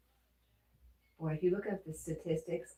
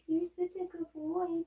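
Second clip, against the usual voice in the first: much higher, squeaky pet praise; 12 semitones higher than usual. Real, not acted. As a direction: push the voice way up to praise the dog. Praising the dog again.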